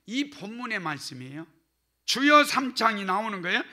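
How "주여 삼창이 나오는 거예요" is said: normal talking voice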